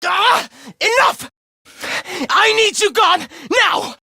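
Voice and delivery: high, revolted outburst; exasperated shout. Acted. Villain sickened by their friendship, exasperated shout. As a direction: cry out high, desperate to be rid of them.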